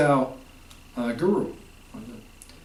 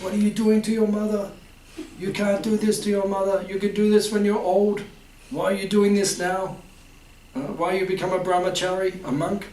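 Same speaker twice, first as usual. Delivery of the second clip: higher-pitched, pleading mother voice; imitating mum; 6 semitones higher than usual. Son retelling his mum's protest, higher and pleading. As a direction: mimic a worried mum's protest.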